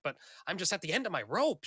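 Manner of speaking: lightly acted exasperation; flustered. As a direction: complain quickly, a touch worn out. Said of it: "she's flustered".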